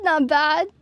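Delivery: usual speaking voice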